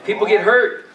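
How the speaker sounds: usual speaking voice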